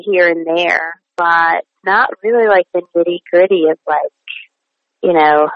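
ordinary talk

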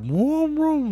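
high, airy imitated engine rev; mock engine revving sound; engine vroom. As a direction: make the car rev up.

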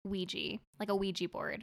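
Ouija said as WEE-jee; both times Ouija ends in "jee"; wee-jee pronunciation. Shown as a demonstration. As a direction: say Ouija with an "ee" ending. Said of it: "I've always pronounced it".

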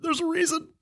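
high, soft, put-on innocent plea; mock pleading. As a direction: pretend to beg the tribe to believe. Faking grief to the tribe.

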